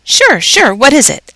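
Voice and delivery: a keen principal offering help; eager principal. A narrator's reading. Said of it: "asked Principal Morales".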